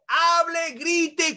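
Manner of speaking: loud demo of over-pitched energy; demonstrated shouting. Shown as a demonstration. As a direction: push the voice up as if forcing someone. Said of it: "como yo estoy gritando".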